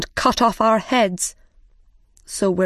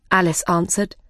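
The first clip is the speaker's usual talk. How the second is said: ordinary delivery, nothing marked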